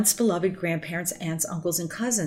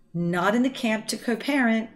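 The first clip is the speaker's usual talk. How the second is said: ordinary talk, nothing marked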